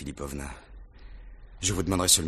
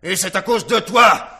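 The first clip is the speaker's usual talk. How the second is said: much higher and louder, raging; furious shout; 13 semitones higher, 8 dB louder than usual. Acted. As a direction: roar him out of the room. Rogojine raging at Lebedev.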